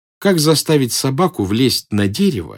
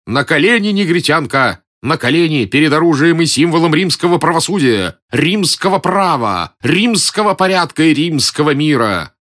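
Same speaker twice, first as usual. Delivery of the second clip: high, loud soldier's command; stern, declaimed Roman bellow; menacing shout; 5 semitones higher, 4 dB louder than usual. A narrator's reading. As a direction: shout it as a threatening order, pitch held up. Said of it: "грозно закричал".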